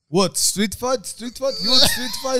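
mock Russian accent rising very high and loud; Russian-accented disbelief, climbing up to a squeal; hammed-up Russian accent, shrill with disbelief; Khabib impression. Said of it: "fucking Khabib's like".

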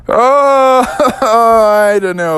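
laughing. Acted. Guests laugh off request.

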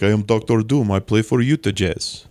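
lightly put-on Eastern European accent; Doctor Doom. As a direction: read it as a villain with a mock Eastern European accent. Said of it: "I've always read him with an accent".

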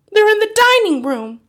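very high, loud alarm; urgent warning from a frightened mother; panicked hiss. A narrator's reading. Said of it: "hissed the mother".

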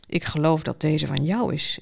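low-pitched man's voice, understated; stranger's voice. A narrator's reading. Stranger returns the ball.

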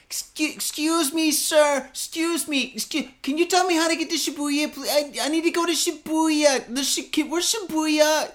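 high, pleading tourist voice; hammed-up high-pitched plea; whiny foreign lady. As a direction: mimic an annoying lady begging for directions. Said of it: "and she goes".

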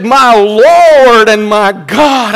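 loud, high, drawn-out exclamation; awestruck astonishment. Acted. Preacher voicing Thomas touching Jesus, awestruck astonishment. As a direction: cry it out in excited awe. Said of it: "hear the excitement, the astonishment, the awe in his voice".

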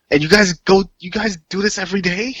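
high amazed question; awed disbelief. Pretend. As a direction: gasp it out in wonder.

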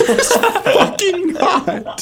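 slow words through high laughter; laughing. Real, not acted. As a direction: deny it while laughing.

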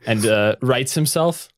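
normal talking voice

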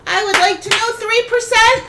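high, loud exasperated shout at the roll; outraged yelp. Real, not acted. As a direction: yell the bad number in disbelief. Player groans at a bad roll.

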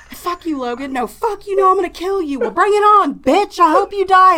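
shrill, loud sibling screaming match; mock screaming fight. Mother mimicking her sons screaming at each other. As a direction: yell it like two kids fighting room to room. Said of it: "are screaming at each other".

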